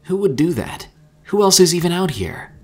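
high, loud, indignant questions; angry bewilderment. A narrator's reading. Herschel demands to know who wrecked the relay, angrily. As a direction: demand answers in alarm.

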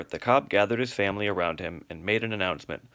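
ordinary delivery, nothing marked

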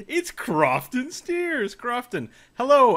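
slow, high, building to a loud hello; announcer introduction. Acted. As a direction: hype up the guest's name. Host announces guest.

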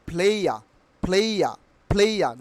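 "player" as "phlei-ya"; correct pronunciation. Shown as a demonstration. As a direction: say "player" with no R at the end. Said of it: "it will be pronounced only". ("player", শেষে র ছাড়া)

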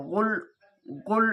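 "গুল" with a heavy throaty first consonant, stopped on the l; thick, heavy sound. Shown as a demonstration. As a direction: say "গুল" thickly and close on a still l. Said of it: "একটু মোটাভাবে". ("গুল" মোটাভাবে উচ্চারণ)

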